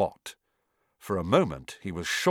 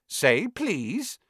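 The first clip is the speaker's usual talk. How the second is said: teasing poltergeist; 9 semitones higher than usual. A narrator's reading.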